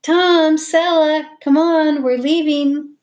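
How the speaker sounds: high, loud call across a garden; mother calling. A narrator's reading. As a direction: summon children from far off. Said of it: "their mother's voice echoed across the garden".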